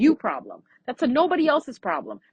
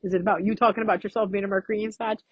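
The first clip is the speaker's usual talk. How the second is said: viewer voice; 4 semitones lower, faster than usual. Retold or imitated. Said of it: "you're like".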